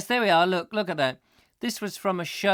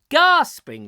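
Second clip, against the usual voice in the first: hammed-up emphasis; 10 semitones higher, 11 dB louder than usual. Real, not acted. Host dying for tea.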